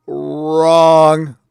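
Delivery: long, loud held-out word; loud rebuttal. Real, not acted. Reviewer repeating his verdict on the clip, loud drawn-out call. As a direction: hold the verdict out, loud and level.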